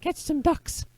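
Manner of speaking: high, slow, put-on boy voice; joke boy voice. Boy answering his uncle by the river, high boyish voice. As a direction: pitch way up as the cartoonish lad. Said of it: "And the boy goes".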